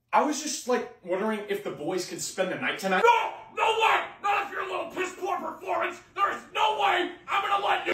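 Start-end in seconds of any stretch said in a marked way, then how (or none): 3.1-7.9 high-pitched outraged yelling; angry dad rant. Acted. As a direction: tear into your son, high and strained. Dad angrily refuses sleepover.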